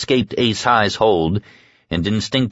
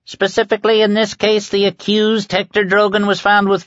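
high-pitched official reading; auctioneer-like proclamation; 12 semitones higher than usual. A narrator's reading. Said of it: "like an auctioneer".

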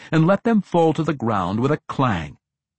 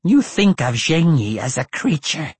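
ancient lich voice; 4 semitones higher, 5 dB louder than usual. A narrator's reading. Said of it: "the Lich explained".

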